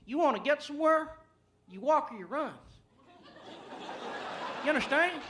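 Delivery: a cocky dismissive president; mock Bush answer. Bush answering reporters, mock dismissive. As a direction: pitch up, brushing off the question. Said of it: "Like, you know".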